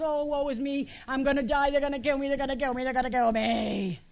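hammed-up woe-is-me wail; mock crying. Preacher acting Elijah crying under the broom tree, mock crying. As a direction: imitate a panicked, self-pitying whine, running the repeats together. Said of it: "he starts to cry".

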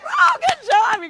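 high, loud excited cheer; excited praise. Real, not acted. Praising the group for answering Rahab, high and loud. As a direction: cheer them on for getting it.

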